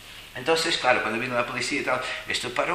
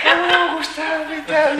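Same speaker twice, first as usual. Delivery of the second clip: much higher, sing-song caller's greeting; little high voice; 7 dB louder, much slower than usual. Retold or imitated. Imitating the monk greeting him on the phone, high sing-song. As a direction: mimic a dainty little voice. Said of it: "con una vocecita".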